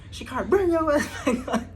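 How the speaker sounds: loud laughing call across the room; high loud shout from the back. Retold or imitated. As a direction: yell it over the noise, laughing. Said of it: "I hear him in the background".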